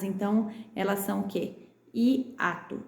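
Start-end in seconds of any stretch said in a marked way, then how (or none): 1.9-2.8 "hiato" split i - ato; a gap after the first vowel of "hiato"; separated syllables. Shown as a demonstration. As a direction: sound out i, then ato. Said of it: "Elas estão em sílabas separadas".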